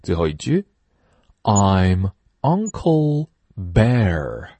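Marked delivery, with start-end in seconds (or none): 1.4-4.5 slow, drawn-out model sentence; slow teacher model: I'm Uncle Bear; slow model reading. Shown as a demonstration. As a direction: say it slowly for the class. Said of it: "model sentence for repeating".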